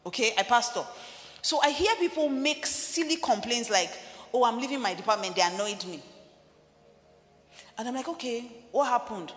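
impassioned sermon voice; full-force preaching. Acted. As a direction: preach it hard to the congregation.

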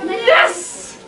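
high, bright cheer; gleeful yes. Retold or imitated. Kids marching home with the frog, gleeful cheer. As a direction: cheer for joy. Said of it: "We were like".

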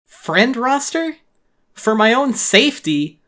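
outraged dawning horror, cut off; horrified disbelief. Acted. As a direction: choke on the realization. Billy's horrified reaction.

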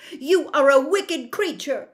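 loud, high-pitched indignation; angry cry. A narrator's reading. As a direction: cry out the accusation as angry Dorothy. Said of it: "cried Dorothy".

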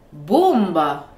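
"bomba" said BOMba, first syllable loud; stress on first syllable. Shown as a demonstration. Teacher showing stress on a real word, "bomba" stressed at the start. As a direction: hammer the BOM of "bomba". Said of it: "voici comment ça sonne avec de vrais mots".

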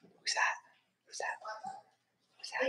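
whisper. Acted. Coaching bird to talk.

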